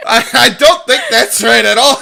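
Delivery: high, loud, laughing through it; cracking up in disbelief. Real, not acted. Host laughing at the guest's intro, loud and high.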